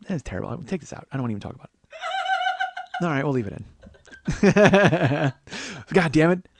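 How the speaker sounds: normal talking voice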